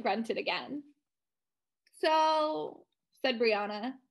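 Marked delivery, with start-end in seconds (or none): 2.0-2.9 higher, held-out bored "so"; bored drawl. A narrator's reading. Brianna opening a question to the girls, bored drawl. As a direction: stretch it out as if bored. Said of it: "sounding totally bored".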